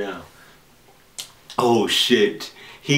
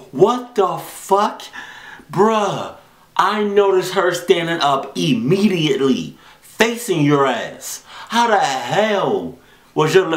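exasperated scolding; 6 semitones higher than usual. Real, not acted. Viewer scolding the cameraman's late reaction, exasperated. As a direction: berate him, stretching words in disbelief.

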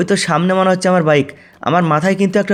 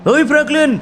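calling out; 6 semitones higher than usual. Acted. Policeman calling out.

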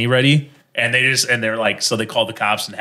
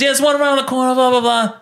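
high mock New Yorker; New York accent; 13 semitones higher than usual. Italian local giving a tourist directions, high New York accent. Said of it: "That was a New York versus Brooklyn".

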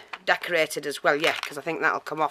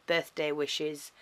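usual speaking voice

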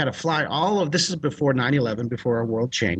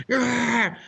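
high scream, not at full volume; comic reenacted "aah" of panic; mock scream; 8 semitones higher than usual. Storyteller refusing to let go of the dolphin, mock scream. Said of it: "so I'm screaming".

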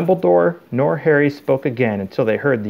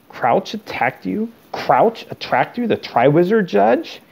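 high, slow, rising disbelief; incredulous; 8 semitones higher than usual. A narrator's reading. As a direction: repeat it in mounting, outraged astonishment. Karkaroff astonished at Krum's report.

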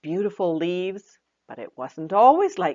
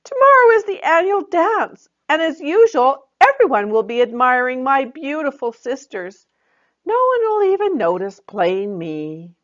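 much higher, louder sulky lament; complaining; 9 semitones higher, 6 dB louder than usual. Acted. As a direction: pour out the envy of plain little Autumn. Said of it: "Autumn complained".

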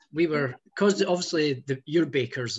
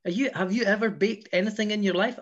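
higher-pitched imitation of a scornful challenger; mock indignant challenge; 6 semitones higher than usual. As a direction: challenge them as if affronted, half in play. Imagined challenge from the bakers.

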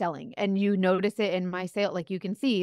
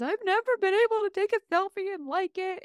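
lightly voiced customer, higher and teary; tearful customer; 11 semitones higher than usual. Customer thanks her for the makeup, mock teary. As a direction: gush like a moved customer. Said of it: "just crying crocodile tears".

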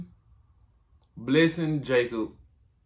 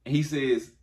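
ordinary talk, nothing marked

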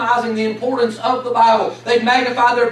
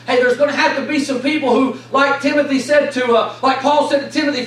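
ordinary talk, nothing marked

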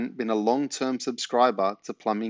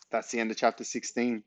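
ordinary delivery

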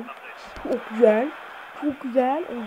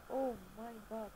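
tense whisper; 12 dB quieter than usual. Real, not acted. Tense moment while playing.

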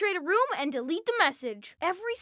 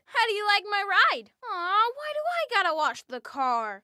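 ordinary talk, nothing marked

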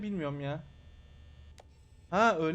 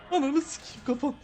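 frightened curse; 7 semitones higher, 5 dB louder than usual. Real, not acted. Jumpscare in horror game.

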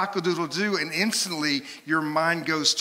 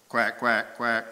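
imitated duck quacking in short bursts; 4 semitones lower, slower than usual. Shown as a demonstration. Pastor's animal sound quiz, playful duck quacks. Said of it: "I'm gonna make a sound".

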